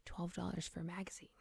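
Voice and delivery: soft whisper close to the mic. Real, not acted. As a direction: breathe it into the microphone. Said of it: "You're really into, like, whispering into the microphone".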